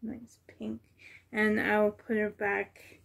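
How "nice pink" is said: murmured approval, unvoiced; soft whisper. Real, not acted. Admiring the pink onesie, a whisper. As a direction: breathe it out to yourself.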